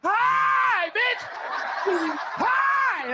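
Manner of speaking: shrill mock yelling greeting; mock yelled hello. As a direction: scream the greeting absurdly. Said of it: "You think I said".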